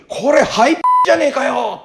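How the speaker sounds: high, loud blurted exclamation; exclaimed retort. Retold or imitated. Teacher retelling his blurt at the student's tape, exclaimed.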